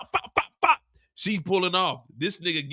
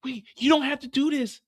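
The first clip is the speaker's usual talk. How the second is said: higher, desperate wait wait; frightened pleading; 8 semitones higher than usual. Acted. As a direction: beg him to stop, pleading.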